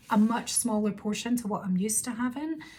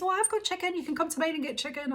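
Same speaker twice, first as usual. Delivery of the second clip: much higher, chirpy mum voice; imitating mum; 8 semitones higher, faster than usual. Mum on the phone offering her chicken, high imitation. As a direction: put on mum's bright helpful voice.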